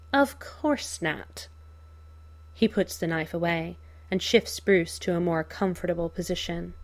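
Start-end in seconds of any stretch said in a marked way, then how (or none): 0.1-1.5 higher, slow mocking reply; drawn out with mock innocence; Joker voice. Joker answers Bruce mockingly.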